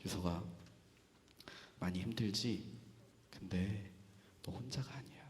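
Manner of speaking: very soft, low and tender; hushed, intimate reassurance; Jesus consoling. Acted. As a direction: speak gently to someone hurting, with long pauses. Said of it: "예수님이 저에게 이렇게 말씀하셨어요".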